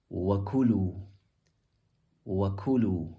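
"وَكُلُوا" with the ku stressed; "وَكُلُوا", peak on ku then drop. Shown as a demonstration. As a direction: lean on the middle syllable of "وَكُلُوا". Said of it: "but more eloquent".

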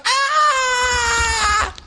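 high squealing fan shriek, hammed up; shrill, excited fan squeal, mocked; mock fangirl scream. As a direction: imitate a crowd of screaming girls. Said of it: "and they're all like".

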